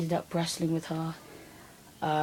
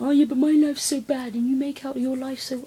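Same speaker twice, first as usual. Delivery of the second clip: arsey sister; 8 semitones higher, 4 dB louder than usual. Retold or imitated. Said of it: "she started getting all arsey".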